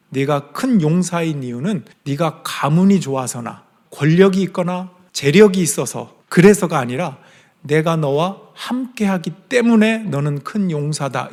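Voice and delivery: ordinary talk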